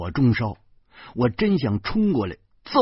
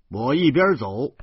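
ordinary delivery, nothing marked

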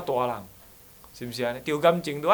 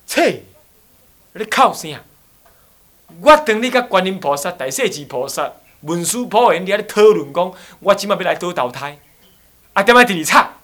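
scolding; 7 dB louder than usual. Retold or imitated. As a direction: bark at the weeping disciple. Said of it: "骂那个徒弟说". (师父大声责骂徒弟)

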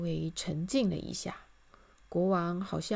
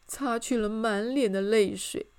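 ordinary delivery, nothing marked

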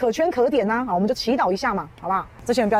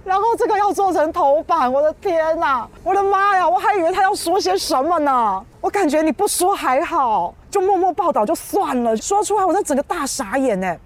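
exasperated disbelief; 8 semitones higher, 4 dB louder than usual. Real, not acted. Exasperated at front-page story.

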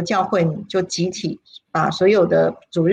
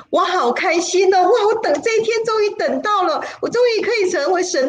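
much higher, voice breaking with happy tears; joyful tearful cry; 11 semitones higher than usual. Retold or imitated. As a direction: cry out in overjoyed relief. Joyful over coming baptism.

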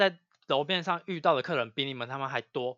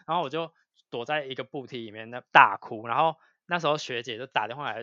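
ordinary talk, nothing marked

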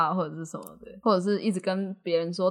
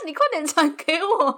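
laughing; 10 semitones higher, 5 dB louder than usual. Retold or imitated. Quote of coworker while laughing.

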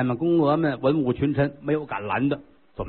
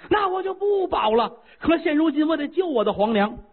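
put-on high, fiery young voice; a hot-headed prince vowing to act; eager young prince; 12 semitones higher than usual. Prince of Lu answers chancellor.

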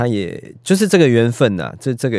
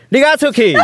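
giggling fake command; laughing mock order; 6 dB louder, faster than usual. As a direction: toss it out while laughing. Imagined reviewer throwing her out.

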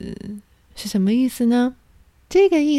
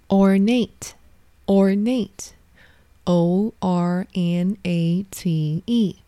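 slow spelling out; much slower than usual. Shown as a demonstration. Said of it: "spells out the new word".

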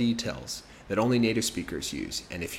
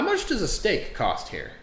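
customer asking; 9 semitones higher, slower than usual. Acted. Guy asks waiter steak price.

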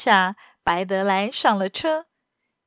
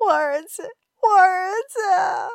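frantic cry; 9 semitones higher than usual. A narrator's reading. Mother learns her son is threatened, frantic cry. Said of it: "维利娜女士语无伦次地喊着".

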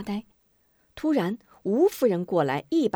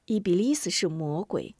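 plain reading voice

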